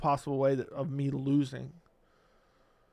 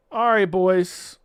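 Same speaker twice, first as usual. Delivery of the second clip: loud sign-off; 3 semitones higher, 8 dB louder, much slower than usual. Real, not acted. Signing off to viewers.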